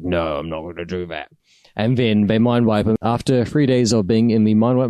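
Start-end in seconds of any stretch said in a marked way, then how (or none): 0.0-1.3 lower, softer quoted refusal; low mock refusal. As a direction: drop low and quiet to refuse. Retold refusal in a story.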